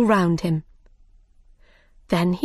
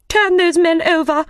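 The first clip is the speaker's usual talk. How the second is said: royal command; 10 semitones higher, 5 dB louder than usual. Acted. The Queen orders the Knave.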